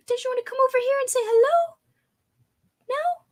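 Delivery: squeaky doggy-directed questions; talking to a pet. Real, not acted. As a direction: coax the dog in a tiny high voice. Coaxing her whining dog.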